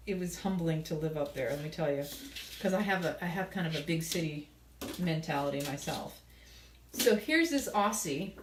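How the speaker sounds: ordinary delivery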